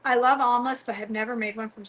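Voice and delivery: ordinary talk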